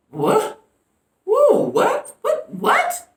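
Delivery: squeaky, sky-high "what?" over and over; can't believe your ears, go way up; shocked disbelief. Real, not acted. Shocked by pastor's words.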